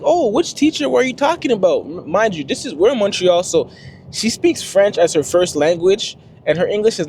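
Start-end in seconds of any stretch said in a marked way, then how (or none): 0.0-1.8 high, puzzled put-on voice of the manager; mock confused manager. Daycare manager pretending not to know, mock confused. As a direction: play dumb in a high voice.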